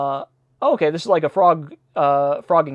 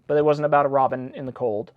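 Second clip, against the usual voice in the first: ordinary delivery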